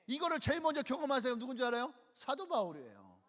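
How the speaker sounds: normal talking voice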